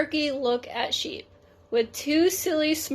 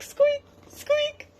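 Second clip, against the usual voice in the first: very high squeaky squeak; wheel squeak. A narrator's reading. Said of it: "the wheels which go around ... with a squeak".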